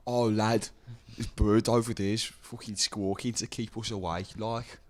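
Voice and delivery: slow, broad Liverpool Scouse; a lad from Liverpool, slow; Scouse accent. Retold or imitated. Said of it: "I thought it was Scouse accent".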